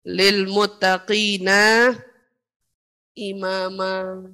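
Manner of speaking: very slow, low, sustained recitation; Quranic recitation. Acted. Preacher reciting the verse's continuation, slow low chant. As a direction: hold each vowel long on a low, level tone. Lihat lanjutan dari ayatnya.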